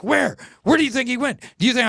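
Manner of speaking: high, urgent, rapid-fire questions; mock starstruck woman demanding answers; excited fan. Woman desperate to find the singer, high and excited.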